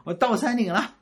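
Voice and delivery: high, retold boastful child's call; 大声喊. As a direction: call up to mum to show off, pitched high. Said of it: "我就大声的喊". (学小孩高声炫耀地喊)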